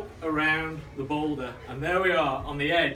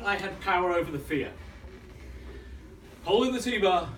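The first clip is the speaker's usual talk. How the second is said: ordinary talk, nothing marked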